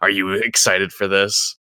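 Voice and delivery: a hint of a sinister game host; creepy horror voice. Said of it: "I'm like".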